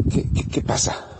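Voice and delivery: a frightened whispered stammer; startled whisper. Acted. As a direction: breathe it out in alarm. María asustada ante el ángel. (susurro asustado y tartamudo)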